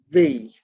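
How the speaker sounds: "V" said distinctly on its own; letter pronunciation. Shown as a demonstration. Teacher explaining the B to students, "V" sounded distinctly. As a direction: set "V" apart as the sound to use. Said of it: "in the middle of the word is pronounced".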